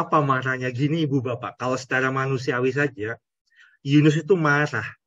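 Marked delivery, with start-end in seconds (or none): none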